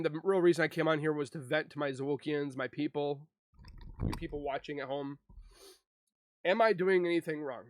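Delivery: normal talking voice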